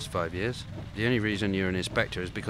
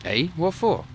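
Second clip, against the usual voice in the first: higher, louder startled question; surprised; 9 semitones higher, 5 dB louder, slower than usual. Acted. Partner startled that the inspector will go in alone, surprised. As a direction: caught off guard, demanding why.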